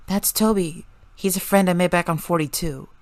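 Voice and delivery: lightly voiced casual girl; breezy young woman. A narrator's reading. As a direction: explain it offhandedly. Melissa voiced higher.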